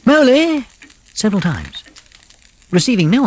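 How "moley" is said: loud high call into the house. A narrator's reading. Rat calling for his friend through the house, loud. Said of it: "Then he called".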